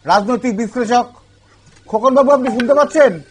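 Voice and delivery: calling over a bad line. Real, not acted. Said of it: "লাইনটা গন্ডগোল হয়ে গেল".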